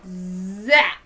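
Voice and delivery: plain reading voice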